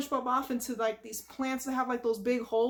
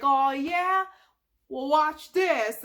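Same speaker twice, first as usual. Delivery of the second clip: louder mock-tough boast, slowing down; manly boast; 4 semitones higher, 6 dB louder, much slower than usual. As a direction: puff up to impress her. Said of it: "he wants to be manly".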